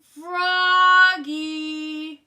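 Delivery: long, loud singsong yell; dad yelling. A narrator's reading. Dad interrupting the saxophone racket, long loud yell. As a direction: bellow it stretched out through the house. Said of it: "yelled Dad".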